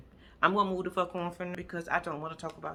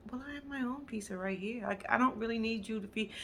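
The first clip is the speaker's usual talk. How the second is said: mimicked woman; 3 semitones higher, 7 dB quieter than usual. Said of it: "she was like".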